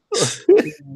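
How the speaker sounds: high burst of laughter. Real, not acted. Friend laughs imagining the bully compilation, high laugh. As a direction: cackle up high.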